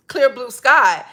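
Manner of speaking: usual speaking voice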